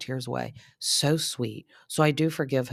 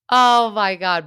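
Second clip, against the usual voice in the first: loud, laughing outburst; delighted oh my god; 7 semitones higher, 11 dB louder, slower than usual. Real, not acted. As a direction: burst out loud, amused by him. Laughing at Jax's question.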